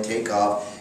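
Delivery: ordinary talk, nothing marked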